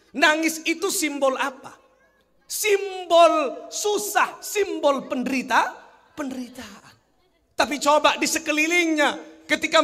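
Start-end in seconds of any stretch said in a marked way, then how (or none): none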